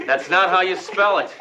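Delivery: chuckling at a mistake; amused laugh. Acted. Laughs at misspelled word.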